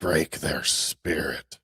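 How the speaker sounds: very slow, low quote; slow ominous quote. Retold or imitated. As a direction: drag out each word ominously.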